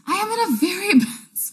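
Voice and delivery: mock despair. Cohost asks how she is, mock-tragic laughing reply. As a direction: play up the gloom for a laugh. Joking about feeling low.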